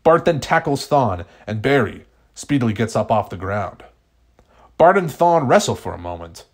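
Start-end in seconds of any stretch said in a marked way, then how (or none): none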